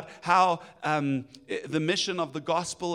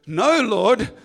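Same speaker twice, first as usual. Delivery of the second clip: exasperated; 7 dB louder than usual. Retold or imitated. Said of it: "He goes".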